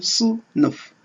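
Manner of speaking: "sınıf" slowly separated into two syllables; split into syllables. Shown as a demonstration. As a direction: say "sınıf" with a gap between its syllables. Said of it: "dans chaque syllabe doit". (« sınıf » découpé en deux syllabes)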